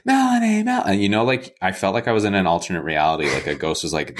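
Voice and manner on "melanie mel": high call across the street; retold call to a friend walking by. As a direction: yell her name to get attention. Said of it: "waving frantically".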